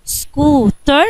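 "سكوتر" in two drawn-out beats: scoo, ter; split into syllables. Shown as a demonstration. Pupil answers teacher, "سكوتر" syllable by syllable. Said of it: "قسمتها تو سيلابلز".

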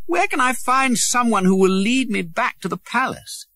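put-on high young prince voice, pleading; a boy begging his way home; lost boy prince. A narrator's reading. Lost prince asking the way, high and pleading.

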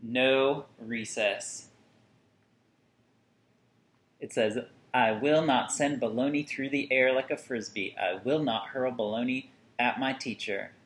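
ordinary delivery, nothing marked

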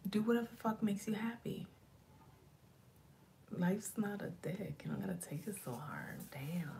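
playful hushed whisper; mock whisper. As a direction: whisper it like a secret bit of wisdom. Whispered advice, then "just kidding.

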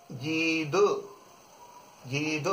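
जीद, short and soft, for "guide"; French "guide" as जीद; French pronunciation. Shown as a demonstration. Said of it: "इसको पढ़ेंगे अपन".

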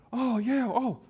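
high, loud, gushing enthusiasm; fawning eagerness. Acted. Talking to a Fortune five hundred CEO, gushingly eager. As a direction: gush as if meeting someone important.

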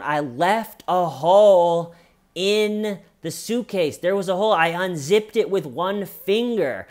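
high, insistent self-defence; imitated indignant protest. Suspect says she left a hole, mock indignant protest.

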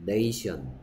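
네이션 with "ay" gliding in 네이, pitch falling; pronunciation demo. Shown as a demonstration. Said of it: "하지만 얘는 발음이 뭐라고?".

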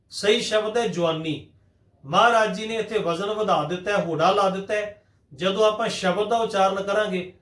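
ordinary talk

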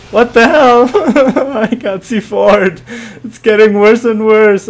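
high, loud, laughing protest; laughing disbelief. Real, not acted. Gamer blown up as things get worse, laughing disbelief. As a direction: cry out in dismay through laughter.

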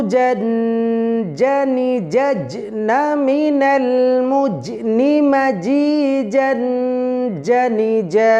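জা, জি, জু chanted in a rhythmic drill; jim practice drill. Shown as a demonstration. Teacher leading a jim practice drill, rhythmic chant. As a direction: chant each syllable to a steady beat. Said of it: "ভিন্ন প্রসেসে মস্ক করি".

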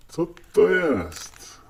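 high, slow, surprised question. Real, not acted. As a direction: puzzle over the odd toy. Surprised at the toy.

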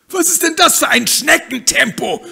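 high, loud, appalled cry. Acted. Hen appalled at the duck's slowness, loud. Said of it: "fragt das Huhn entsetzt".

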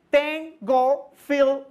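"Tengo filo" drawn out very slowly, gringo style; phonetics demo. Shown as a demonstration. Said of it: "clases de fonética para gringos". (demostración fonética lenta)

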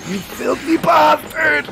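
high, slow shouted insult; shrieking slow outrage; furious shout. Real, not acted. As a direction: yell it at the enemy in outrage. Enemy ambush.

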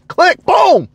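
high punchy click then boom; hammed-up hang-up sound; emphatic click boom. Real, not acted. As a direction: snap it out like slamming the phone. Sound of hanging up.

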